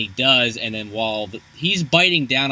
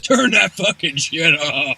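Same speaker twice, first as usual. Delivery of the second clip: mock cop yell; 6 semitones higher, 4 dB louder, slower than usual. Cops yelling about loud music.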